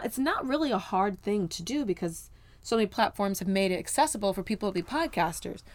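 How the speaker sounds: normal talking voice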